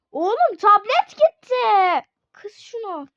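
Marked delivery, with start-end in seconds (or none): 0.1-1.5 high, loud cry of alarm; panicked yell. Real, not acted. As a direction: shout it in disbelief. Tablet lost again, yelling.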